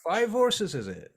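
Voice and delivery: mock them for asking the obvious; sarcastic stablehand. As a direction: stablehand sneering at the party. Stablehand counts horses sarcastically.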